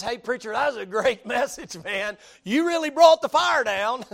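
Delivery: hammed-up praise, high and loud; mock the thanks nobody gave; grateful churchgoer. Preacher imagines townsfolk thanking Elijah, loud mock praise. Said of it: "Nobody says".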